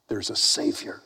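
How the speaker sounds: whispered good news; soft, breath-only announcement; hushed revelation. Acted. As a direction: pass it on like a secret. Preacher passes on the hushed buzz.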